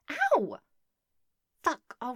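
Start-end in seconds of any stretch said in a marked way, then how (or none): 0.1-0.6 loud high yelp of pain; sharp yelp. Acted. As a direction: yelp as if struck hard. Cry at spanking.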